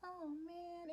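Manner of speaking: quiet, high playful mimicry; Dora cartoon quote. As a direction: quote a kids' show in a high, small voice. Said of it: "anyone else as a kid used to watch Dora?".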